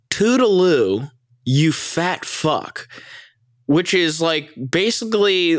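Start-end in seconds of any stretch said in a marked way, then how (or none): 0.1-2.9 higher, slow singsong "Toodaloo", drawn-out "you fat fuck"; sassy mock farewell, again. Friend repeating Erica's epic line, slow sing-song. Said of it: "let me just repeat that".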